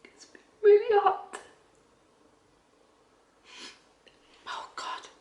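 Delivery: high, breaking whisper through real tears; crying, almost no voice; tearful whisper. Real, not acted. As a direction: choke the words out, barely voiced. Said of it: "I hate crying on camera".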